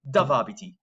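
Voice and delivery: "Dove abiti" as English "dove", DUV, dropping the E; English-accented Italian. Shown as a demonstration. Teacher showing a low-mark pronunciation, English style. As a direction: swallow the E in "dove" like an English learner. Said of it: "wouldn't get you the best mark".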